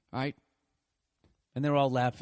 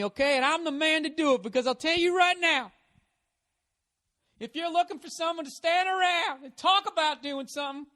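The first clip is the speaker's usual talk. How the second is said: much higher, loud blustering stump speech; macho presidential candidate; 12 semitones higher, 4 dB louder than usual. Pretend. Male candidate pitching to voters, blustering loud. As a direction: ham up a chest-thumping politician at the podium. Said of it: "You can't be like".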